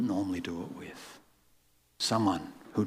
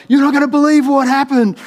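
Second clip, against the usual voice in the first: much higher and louder, bursting with news; excited; 17 dB louder than usual. Retold or imitated. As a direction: blurt it out like she can't hold it in. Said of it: "She was so excited".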